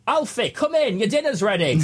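high northern dad call, dropping at the end; calling children in. Acted. Dad calling his son in for dinner, calling out. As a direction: call the lad in convincingly. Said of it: "convincingly come outside and go".

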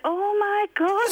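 retold dismay.

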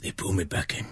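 low, very soft film-mobster voice; struggling attempt at a hushed, low mafia boss; Al Pacino impression. Co-host attempting Godfather three line, low Al Pacino impression. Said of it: "I could do Al Pacino".

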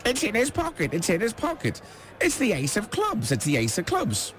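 high squawking parrot voice. Acted. Parrot squawking the magician's secret. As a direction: squawk it like a tattling ship's parrot. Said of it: "the parrot would be there in the background squawking".

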